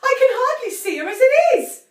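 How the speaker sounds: high, loud distressed wail. A narrator's reading. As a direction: wail in despair. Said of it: "Missus Cranky wailed".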